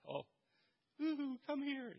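high, soft sing-song woo-hoo; mock gentle coaxing; wrong way, soft sing-song; mild beckoning. Shown as a demonstration. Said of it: "he's yelling, not".

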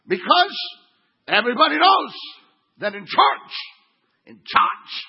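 loud, high proclamation in short separated bursts; hammed-up indignant declaration, a pause after each phrase; mock righteous shouting. Religious churchgoer lecturing the pastor, mock righteous shouting.

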